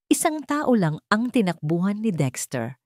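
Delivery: ordinary delivery, nothing marked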